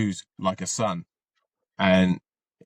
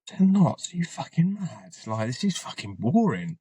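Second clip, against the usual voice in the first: incredulous disbelief; 3 semitones higher than usual. Retold or imitated. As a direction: scoff as if ten knots is a joke. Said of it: "And I thought".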